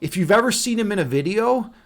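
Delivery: ordinary talk, nothing marked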